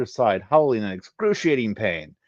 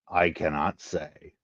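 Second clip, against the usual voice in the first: low, slow, softer refusal; terse refusal; 5 semitones lower, 4 dB quieter, slower than usual. Acted. He refuses to tell Genesis, low and slow. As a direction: hold back, low and deliberate.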